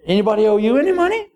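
lightly voiced king. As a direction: rattle it off as the king. King questioning fast.

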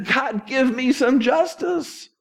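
high, slow plea for justice; Job's slow, high pleading lament. Retold or imitated. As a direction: cry out to God as Job, slowly. Voicing Job's lament to God.

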